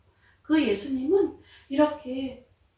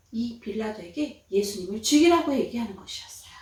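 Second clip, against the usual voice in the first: ordinary delivery, nothing marked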